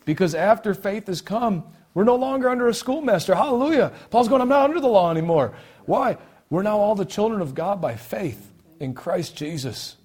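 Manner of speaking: loud, high preacher in full cry; exultant preaching. Real, not acted.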